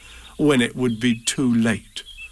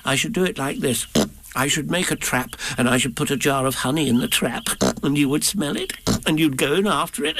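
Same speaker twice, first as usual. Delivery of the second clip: lightly voiced Piglet. Piglet explaining plan.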